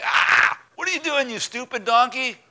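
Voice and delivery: high, loud angry outburst; a rider losing his temper at his beast; exasperated yell at donkey. Retold or imitated. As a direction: snap at the stubborn donkey. Balaam yells at his donkey.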